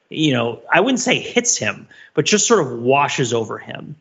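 normal talking voice

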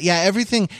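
normal talking voice

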